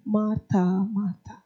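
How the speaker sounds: soft, tender calling of her name; loving. Retold or imitated. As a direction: gently call her back with love. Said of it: "with a lot of love".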